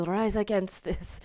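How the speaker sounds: badly faked Jamaican rallying cry; rally them in a shaky-guess Jamaican accent; attempted Jamaican accent. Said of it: "a Jamaican".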